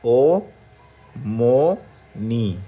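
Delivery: ओ, मो, नी called out loud one by one; loud syllables. Shown as a demonstration. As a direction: call each syllable ओ मो नी. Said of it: "पहला है".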